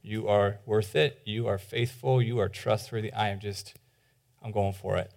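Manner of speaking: quiet prayer. Acted. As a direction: pray it softly, as if alone with God. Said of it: "you say".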